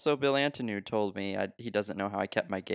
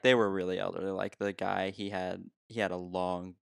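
ordinary delivery

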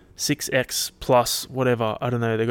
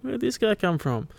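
mock shock; 10 semitones higher, 3 dB quieter than usual. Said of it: "You're like".

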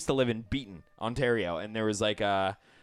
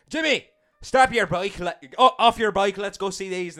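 put-on Northern Irish accent, higher and louder; Northern Irish dad; 8 semitones higher, 6 dB louder than usual. Dad telling son to stop his bike, Northern Irish accent. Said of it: "Northern Irish".

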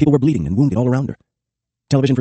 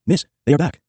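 raised, urgent yell; a narrator's yelled warning, pitched higher; 6 semitones higher, 3 dB louder than usual. A narrator's reading. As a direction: call out the warning in alarm.

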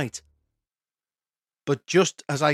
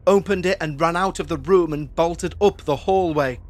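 ordinary delivery

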